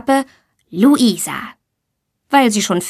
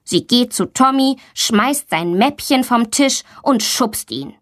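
ordinary delivery, nothing marked

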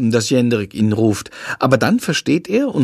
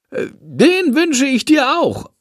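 much higher, slow bat voice; a slow, squeaky little bat answering politely; bat replying; 13 semitones higher, slower than usual. A narrator's reading. The bat Shandor answers Lilly's greeting, high and slow. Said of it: "antwortet Shandor".

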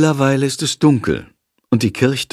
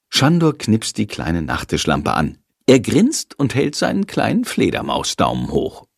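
plain reading voice

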